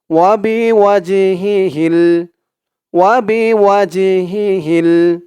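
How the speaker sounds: Wazed chanted slowly; slow recitation. Shown as a demonstration. Teacher reads syllables for students, slow. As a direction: draw out each syllable. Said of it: "তো আমার সাথে বলুন".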